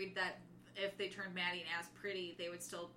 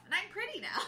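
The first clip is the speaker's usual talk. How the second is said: high, dreamy mock voice; lightly voiced happy airhead; airy Shay; 11 semitones higher than usual. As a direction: play her blissfully vacant. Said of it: "Shay's like".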